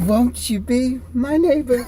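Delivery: high, slow, theatrical British host; hammed-up creepy neighborly invitation; Stardust. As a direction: stretch it out in a lofty, high put-on voice. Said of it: "I need an impression of you being Stardust".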